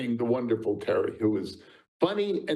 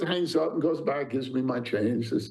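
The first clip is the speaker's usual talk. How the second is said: ordinary talk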